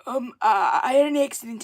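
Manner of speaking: a hint of a hesitant character, halting on the ums; male character's voice. Shown as a demonstration. Streamer demonstrating his puppet character's voice, lightly put-on. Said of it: "He talks like that".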